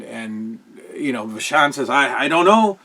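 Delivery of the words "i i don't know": put-on lightly done Canadian accent, unsure; a hint of a Canadian accent, a bit helpless. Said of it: "heavy Canadian accent".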